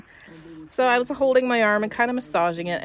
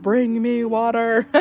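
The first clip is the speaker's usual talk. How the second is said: louder and higher, slowed plea; demanding; 6 semitones higher, 9 dB louder, slower than usual. Retold or imitated. Telling her husband to bring water, mock-loud demand. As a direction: beg for it, loud and drawn out. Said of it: "I was like".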